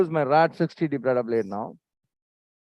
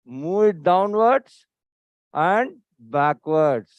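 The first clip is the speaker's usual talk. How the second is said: slow demonstration; 3 dB louder, much slower than usual. Shown as a demonstration. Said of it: "You go downwards".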